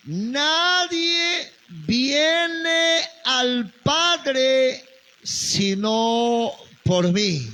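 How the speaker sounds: "viene" drawn out high and very slowly; "Nadie viene al Padre" preached very slowly with "viene" lifted; stress on viene. Shown as a demonstration. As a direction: lean hard on "viene", stretching each word. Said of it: "No dice Jesús, "Nadie va al Padre".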